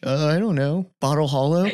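clueless, shrugging guess; dumb soldier voice. Retold or imitated. Voicing a soldier naming the lake.

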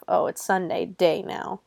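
usual speaking voice

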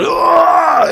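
mock battering cry. Miming a battering ram blow.

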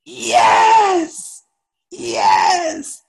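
very high, stretched-out triumphant shout; hammed-up teacher bellowing approval; imitated yelling. Teacher finally hears the right answer, imitated yell of approval. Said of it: "He just kept yelling".

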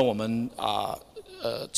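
ordinary talk

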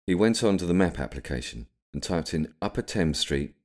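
ordinary delivery

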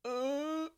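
soft, high held whine; quiet squeak of dismay; tiny high "uh"; reaction noise. Retold or imitated. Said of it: "I'm like".

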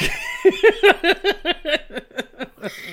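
high burst of laughter; big laugh. Real, not acted. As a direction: crack up loudly. Cracking up at joke.

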